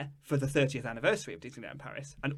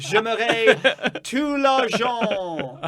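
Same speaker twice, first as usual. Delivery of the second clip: mock French accent; 6 semitones higher, 4 dB louder, much slower than usual. Mocking Eisner in French.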